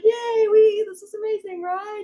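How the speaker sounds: high-pitched cartoonish celebration from the guides; cheering spirit guides. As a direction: squeal like a crowd of happy helpers. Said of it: "they're all like".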